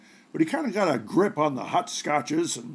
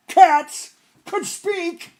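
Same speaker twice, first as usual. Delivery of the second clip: much higher, slow, drawn-out wonder; amazed disbelief; 13 semitones higher, 7 dB louder, much slower than usual. A narrator's reading. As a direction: stretch it out in mock astonishment. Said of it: "old man wonders at dog".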